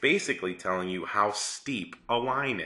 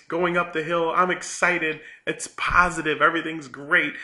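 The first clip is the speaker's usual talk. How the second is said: higher, louder cheerful recap; excited; 9 semitones higher, 6 dB louder than usual. Retold or imitated. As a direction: bounce it like a thrilled skier.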